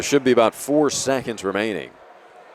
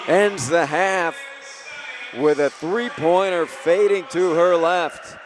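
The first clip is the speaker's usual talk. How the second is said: excited call; 6 semitones higher, slower than usual. Real, not acted. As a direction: call the buzzer-beater with building excitement, stretching her name out slowly. Buzzer-beater call at the half.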